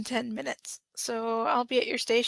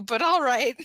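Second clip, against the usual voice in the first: louder, playful concession; teasing; 3 semitones higher, 7 dB louder than usual. Acted. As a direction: give in with a tease. Teasing banter on phone.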